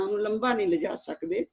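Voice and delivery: usual speaking voice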